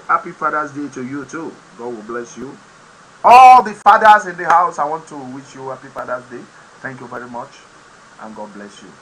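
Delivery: ordinary delivery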